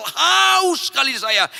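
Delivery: shouted high and slow, long drawn haus. Retold or imitated. Preacher recalling his thirst, shouting it out. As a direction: cry out the thirst at full voice.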